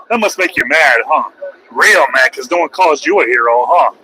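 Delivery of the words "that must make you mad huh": ordinary talk, nothing marked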